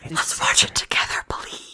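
breathy whisper, no voice. Real, not acted. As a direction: whisper it close to the mic. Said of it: "that was probably really bad for the mic".

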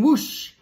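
loud, rushing whoosh. A narrator's reading. Teacher voices the broomstick taking off, loud whoosh. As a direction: let the word burst out like take-off.

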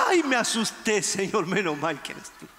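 mock relief after a scare; Peter relieved from fright. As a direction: sigh it out, dropping away. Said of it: "no podía decir Pedro".

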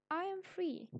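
high, joyful exclamation. Shown as a demonstration. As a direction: exclaim it like someone just out of jail. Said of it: "so he's super happy".

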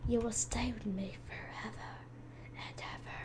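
hammed-up sinister whisper, forever and ever; creepy whisper. Creepy pretend villain line.

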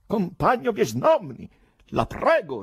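high, slow, backing-off protest; flustered plea. A narrator's reading. Embarrassed man retreating from the poet, flustered. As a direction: plead with alarm in the voice. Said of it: "rispose la faccia arrossendo, arretrando".

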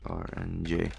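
ordinary delivery

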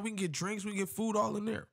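high, eager pitch on the pitch to go in; hyping up the spot, voice way up; excited Keem. Retold or imitated. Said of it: "Keem was like".

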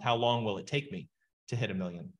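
ordinary talk, nothing marked

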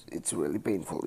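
ordinary talk, nothing marked

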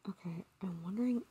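fast hushed whisper; hurried whisper under the breath; quick whisper. Real, not acted. Whispering to viewers mid-call, quickly.

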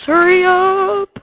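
loud, high, long-drawn call; impatient yell. Real, not acted. Bored player wants the game to move, impatient yell. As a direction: holler it and stretch the last word.

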